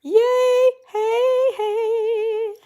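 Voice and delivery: high, loud squealing cheer; excited whoop. Real, not acted. Crocheter finishing the round, squealing with high loud joy. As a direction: celebrate like you just won. Reaching her starting spot, celebrating.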